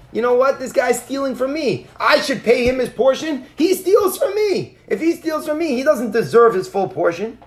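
high-pitched acted outrage, peaking mid-run; indignant protest. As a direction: protest like the wronged party, stretching the accusation. Retold indignant defense against accusation.

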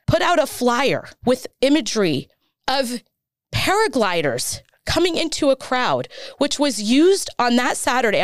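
real distress, pressing every point home; getting emotional. Real, not acted. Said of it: "I'm sorry, I'm getting emotional".

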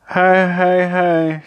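high, drawn-out monotone ho-hos; mournful fake laugh. A narrator's reading. Little Miss Giggles fails at giggling, mournfully. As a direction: intone a joyless laugh like a lament. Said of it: "she added mournfully".

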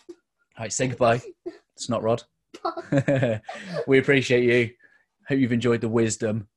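ordinary talk

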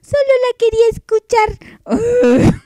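very high, whiny mock excuse; mocking listener imitation. Host imitating the listener who requested the song, mocking high whine. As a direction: mimic her excuse in a high squeaky whine. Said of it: "que me salió con que".

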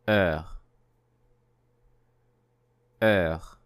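Heure said with no H and a rounded vowel; model word. Shown as a demonstration. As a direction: say Heure slowly as a model for repeating. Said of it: "et répétez après moi".

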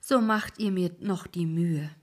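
a weary complaint from the boatman; lightly voiced ferryman's grumble; grumbling old ferryman. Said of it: "sagte der Alte".

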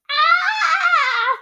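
very high, loud screech; mock scream like a pterodactyl. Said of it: "I sound like a pterodactyl".

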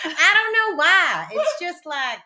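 high, playful Southern twang; exaggerated Southern belle twang, swooping high. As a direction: ham up a Southern drawl. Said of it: "the Southern twang has been coming out".

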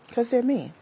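put-on high whiny baby voice; big baby. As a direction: whine it like a big crybaby. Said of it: "Don't I sound like a big baby?".

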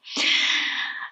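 a long, breathy sigh of dread; heavy sigh. Real, not acted. Stressed traveller sighs over the late bus. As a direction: let out the air like all hope is gone. Stressed about missing her flight.

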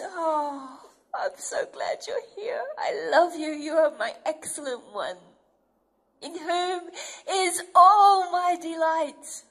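tearful high welcome rising to a slow, loud declaration; God overjoyed at his child; loving father. Acted. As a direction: pour out love on a returning child. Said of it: "He goes".

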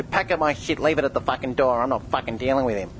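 lightly voiced sulky ex-girlfriend, a mocking parody; a hint of a huffy ex-girlfriend; mocking dejected ex-girlfriend. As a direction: sneer it as a sulking ex would. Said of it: "dejected ex-girlfriend that says".

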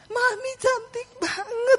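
high, stretched-out squeal of admiration; gushing child. Retold or imitated. Daughter admiring mum's new long hair, gushing. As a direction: gush it slowly in a high child's voice.

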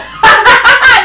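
high, loud burst of laughter; laughing. Real, not acted. Laughing at the vote.

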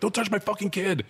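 retold yell. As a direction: bark it like a protective father. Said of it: "So I'm yelling at the doctor".

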